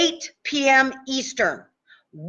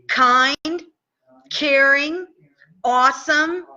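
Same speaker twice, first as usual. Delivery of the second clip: guided tapping script. Acted. Leads a tapping affirmation script.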